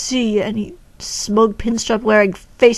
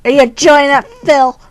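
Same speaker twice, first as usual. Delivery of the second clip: louder taunting question; loud teasing call; 4 semitones higher, 9 dB louder than usual. Real, not acted. Player loudly teasing the character Phil. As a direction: call it out loudly to needle him.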